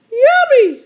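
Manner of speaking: very high, delighted little-sister squeal. Acted. Little sister getting her ice cream, very high delighted squeal. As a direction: squeal it like a cartoon kid. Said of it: "said D W".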